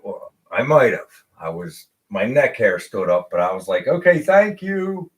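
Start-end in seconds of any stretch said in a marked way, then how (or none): 3.9-5.1 high, slow, overly polite "okay, thank you"; forced politeness. Retold or imitated. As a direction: swallow the anger and sound sweet. Forced politeness after being refused.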